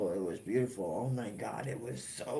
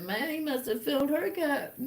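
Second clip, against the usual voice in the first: much higher, breaking into laughter; laughing punchline; 13 semitones higher, 4 dB louder than usual. Retold or imitated. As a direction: crack up on the punchline.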